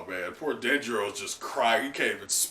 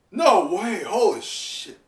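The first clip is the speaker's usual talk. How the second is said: loud, higher stunned outburst; real disbelief; 7 semitones higher, 6 dB louder, slower than usual. Real, not acted. Reacting to Ashura being stabbed, stunned outburst. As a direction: react in genuine shock.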